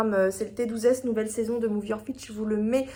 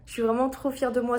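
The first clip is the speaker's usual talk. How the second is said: ordinary delivery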